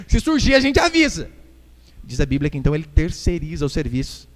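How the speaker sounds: ordinary delivery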